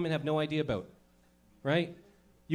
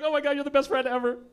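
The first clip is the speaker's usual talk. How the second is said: put-on gushing girl; 5 semitones higher than usual. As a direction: coo thanks like a grateful teen girl. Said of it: "And you're like".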